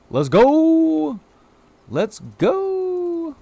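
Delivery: high, drawn-out "go"; hyped cheer. Real, not acted. Streamer celebrating a redeem, high hyped cheer. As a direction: cheer it out, holding the last vowel high. Celebrating viewers' duck bucks.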